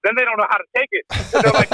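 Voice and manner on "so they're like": normal talking voice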